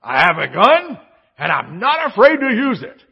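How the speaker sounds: loud, high mock tough-guy threat; hammed-up bold warning; bold declaration. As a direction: boom it out like a proud dad. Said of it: "the bold declaration".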